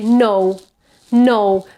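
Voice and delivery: "no" with a back, rounded o; learners' wrong vowel. Shown as a demonstration. Teacher showing what we tend to say, back vowel. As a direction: say "no" from a pure o, as learners do. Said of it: "we tend to say".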